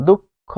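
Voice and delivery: "দুঃখ" said duk-kho with a held k; doubled consonant. Shown as a demonstration. Teacher shows mid-word visarga doubling, "দুঃখ" as duk-kho. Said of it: "উচ্চারণটা হবে".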